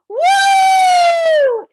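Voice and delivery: very high, loud joyful woo; sledding whoop. Acted. Friends go sledding together, very high loud whoop. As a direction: whoop like racing downhill on a sled.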